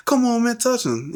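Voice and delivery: high-pitched crowd egging him on; hyping up. Retold or imitated. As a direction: imitate classmates goading a fight. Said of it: "people hyping up in class".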